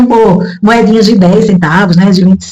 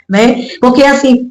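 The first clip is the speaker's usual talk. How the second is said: normal talking voice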